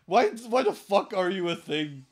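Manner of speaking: loud, exasperated disbelief; mock angry rant. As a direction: lay into the officer with incredulous questions. Imagined rant at border officer.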